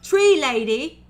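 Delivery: slow, loud, high delighted question; loud slowed tickled orc question. Acted. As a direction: stretch out the delight.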